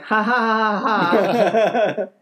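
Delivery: awkward people-pleaser laugh; forced fake laugh. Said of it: "And then I just went".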